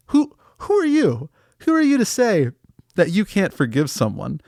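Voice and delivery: high-pitched, affronted outburst; outraged squeak at the listener; indignant. Acted. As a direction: leap up high in offended disbelief. Indignant at listener refusing forgiveness.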